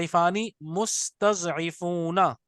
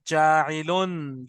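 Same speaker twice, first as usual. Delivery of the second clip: slow and drawn out; 2 semitones lower, much slower than usual. Shown as a demonstration. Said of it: "ये pattern है बनाने वाला".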